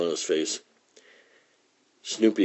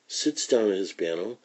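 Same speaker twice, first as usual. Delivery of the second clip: ordinary delivery, nothing marked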